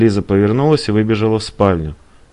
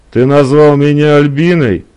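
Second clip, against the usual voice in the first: louder, higher outraged question; indignant girlfriend; 6 semitones higher, 7 dB louder than usual. A narrator's reading. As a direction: challenge him, affronted. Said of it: "возмутилась Элиза".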